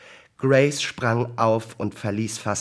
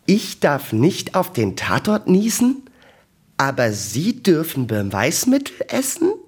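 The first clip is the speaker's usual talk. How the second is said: loud, high-pitched indignant question; 6 semitones higher, 7 dB louder than usual. A narrator's reading. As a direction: challenge the double standard with raised voice. Indignant challenge in acted bickering.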